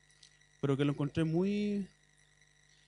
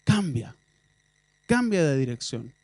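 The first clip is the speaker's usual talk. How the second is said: firm command; 4 semitones lower, 3 dB louder than usual. Shown as a demonstration. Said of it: "lo que está diciendo Jesucristo".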